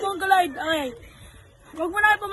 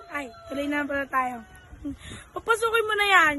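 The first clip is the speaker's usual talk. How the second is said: ordinary delivery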